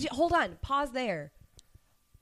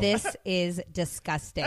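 slow, drawn-out imitated disgust; imitating a friend's slow disgust; mock disgust; 5 semitones lower, much slower than usual. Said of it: "Luis was like".

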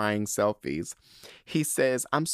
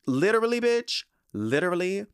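excited exclamation; 9 semitones higher than usual. Real, not acted. Excited about hectic week.

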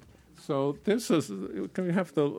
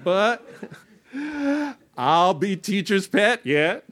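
high, theatrical, half-sung delivery; performed comic lyric; 10 semitones higher, 5 dB louder, slower than usual. Acted. Performer playing an awkward dance pupil pleading with the teacher, high hammed-up singsong. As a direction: ham up the clumsy pupil begging the teacher.

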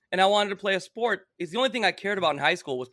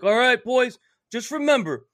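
coach pep talk; 4 semitones higher, 4 dB louder than usual. Retold or imitated. Coach's pep talk before game.

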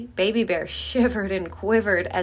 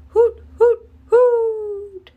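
high, loud owl hoots; owl hooting; 10 dB louder, much slower than usual. Acted. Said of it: "Owl went".

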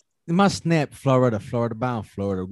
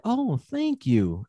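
higher, very slow gentle thanks; soothing thank you; 8 semitones higher, much slower than usual. Retold or imitated. Man imitates drive-thru worker thanking him, higher and very slow. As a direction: mimic her dreamy, slow thank you. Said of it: "She was like".

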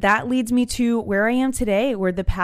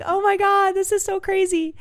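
flipping out; 13 semitones higher than usual. Retold or imitated. Mother and daughter freaking out over the coincidence, high excited squeal. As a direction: squeal it like you can't believe it. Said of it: "We were both flipping out".

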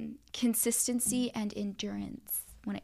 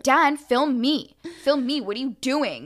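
loud, higher-pitched mock scolding, first words drawn out; mad demand; 4 semitones higher, 8 dB louder, slower than usual. Co-host mimicking her friend mad at the cameraman, loud demand. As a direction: imitate an exasperated friend ordering loudly. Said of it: "getting mad at him".

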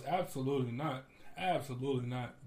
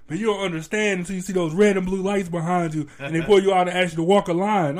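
louder, higher indignant retort; mild mock anger; 4 semitones higher, 12 dB louder than usual. Friend upset at the teasing, a touch of angry lecture. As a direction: imitate a salty friend snapping back. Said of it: "this is how I know he got mad".

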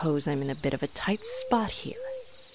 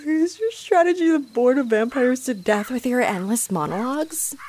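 scornful jab; 7 semitones higher, 5 dB louder than usual. Acted. Mocking his monologue.

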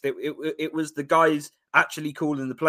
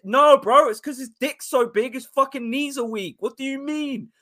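ordinary talk

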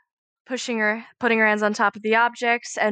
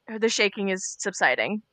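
ordinary talk

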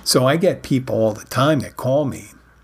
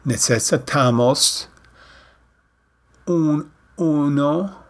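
drawn-out, careful Spanish; slow Spanish; 3 semitones higher, slower than usual. Shown as a demonstration. Showing Spanish speakers how to talk slowly, drawn out. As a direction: stretch each word so a learner follows. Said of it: "you expect them to go".

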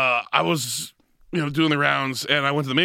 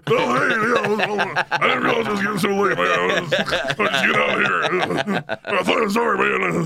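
flustered apology; 7 semitones higher than usual. Retold or imitated. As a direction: scramble out apologising, half laughing. Voices flustered Roddy leaving.

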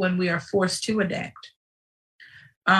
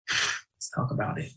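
whisper; 8 semitones lower, 7 dB quieter than usual. Real, not acted. Hushed aside after outburst.

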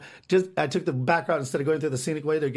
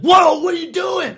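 much higher, loud, slow "whoa" yell; startled shout; 10 dB louder, slower than usual. Retold or imitated. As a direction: yell in alarm at the thrown club. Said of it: "They fucking lost their mind".

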